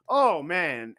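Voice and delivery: ordinary talk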